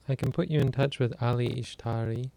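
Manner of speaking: usual speaking voice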